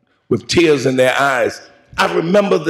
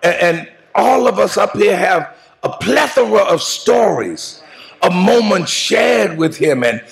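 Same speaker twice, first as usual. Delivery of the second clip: full preaching; 5 semitones higher than usual. Acted. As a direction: preach it out at full force to the mourners. Eulogy preached to the congregation.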